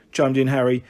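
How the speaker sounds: usual speaking voice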